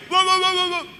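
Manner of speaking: high squeaky mock rant from an angry husband; mock angry outburst. Preacher mimics how some husbands explode in anger, mocking. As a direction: spit out the wahs like a hammed-up tantrum. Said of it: "Kan ada cara marah".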